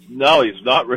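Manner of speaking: usual speaking voice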